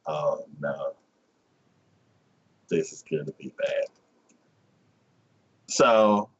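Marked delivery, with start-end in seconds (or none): 0.1-3.8 dreading. Retold or imitated. Boy dreading the scene.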